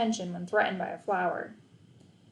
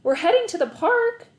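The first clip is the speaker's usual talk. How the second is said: eager invite; 11 semitones higher, 6 dB louder than usual. A narrator's reading. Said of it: "said Eric".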